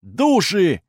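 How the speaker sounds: high, loud alarmed shout. A narrator's reading. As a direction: yell out the warning. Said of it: "закричал Декс".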